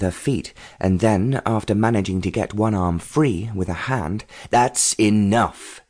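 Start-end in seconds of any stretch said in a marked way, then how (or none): 4.5-5.8 slow, drawn-out mock roar; restrained roar. A narrator's reading. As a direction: order a stop, roaring without much volume. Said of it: "the man roared".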